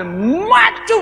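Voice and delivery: high, ringing call to come forward; lightly voiced preacher calling out. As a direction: summon him up like a preacher. Preacher calls him forward.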